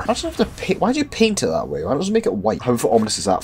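ordinary talk, nothing marked

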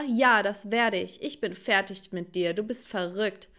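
plain reading voice

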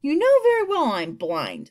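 stung, irritable protest; snapping. A narrator's reading. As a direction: snap back, offended. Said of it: "snapped the earthworm".

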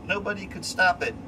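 plain reading voice

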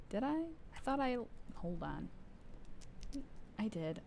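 ordinary talk

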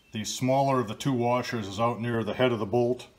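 ordinary talk, nothing marked

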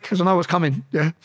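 normal talking voice

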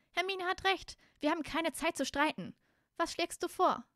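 put-on high, urgent girl's voice; Ginny. Ginny backs Hermine and asks for a plan, high and pressing. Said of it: "meinte Ginny".

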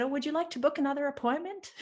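high, quick, chirpy receptionist-like question; blithe offer. Retold or imitated. Coach rebooking a crying client, blithely chirpy. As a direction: trill it lightly as if nothing happened.

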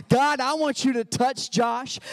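high, loud preacher's prayer opening. Retold or imitated. Pastor praying aloud before thirty people, loud and high. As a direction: launch into prayer with full force. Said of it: "was like".

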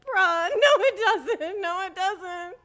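high, loud no it doesn't breaking into laughter; can't stop laughing while refusing; laughing denial. Real, not acted. Customer refusing the sunlight excuse, laughing through it.

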